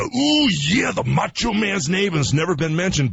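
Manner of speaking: high-pitched "Ooh, yeah" in Macho Man style; Macho Man impression. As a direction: channel the Macho Man's growled catchphrase.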